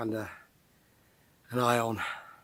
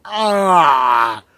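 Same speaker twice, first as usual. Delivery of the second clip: disgusted cry; 12 dB louder than usual. Retold or imitated. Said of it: "and it's like".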